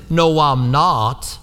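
put-on slow, drawn-out backwater Galilean accent; mock backwater Galilean accent, words stretched out. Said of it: "Peter's accent gave him away".

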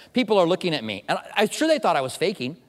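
ordinary talk, nothing marked

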